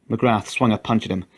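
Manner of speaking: ordinary delivery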